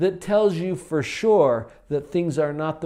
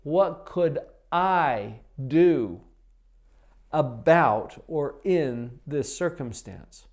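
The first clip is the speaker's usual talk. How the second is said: slow pondering question; slower than usual. Shown as a demonstration. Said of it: "That's the question".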